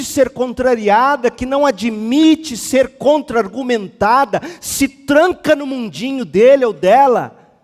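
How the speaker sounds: loud, high, insistent preacher; full-force preaching. Real, not acted. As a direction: hammer each point to the congregation.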